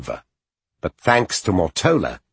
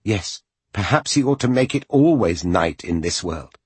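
usual speaking voice

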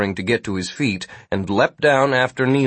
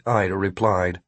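plain reading voice

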